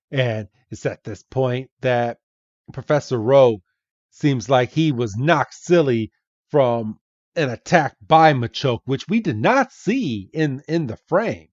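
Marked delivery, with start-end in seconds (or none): none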